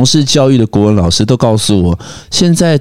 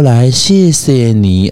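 slow mother's voice; much slower than usual. Retold or imitated.

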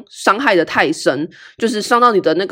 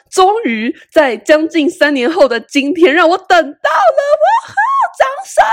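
high and loud, crowing with delight; gloating, calling for applause; gleeful triumph; 10 semitones higher, 3 dB louder, slower than usual. Real, not acted. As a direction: announce your revenge like a victory.

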